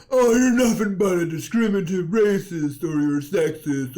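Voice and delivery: loud, high-pitched mock outrage; shouted, exaggerated complaint, drawn out and high; mocking accuser. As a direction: parody an indignant accuser at full volume. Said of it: "I hate it when people wanna say".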